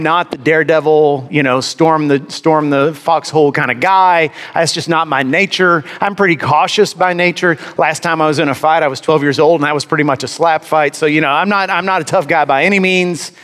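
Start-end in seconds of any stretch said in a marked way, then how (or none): none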